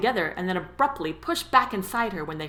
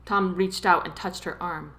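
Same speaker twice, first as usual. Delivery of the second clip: ordinary delivery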